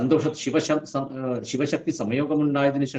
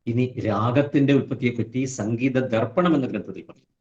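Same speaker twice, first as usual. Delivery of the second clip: ordinary delivery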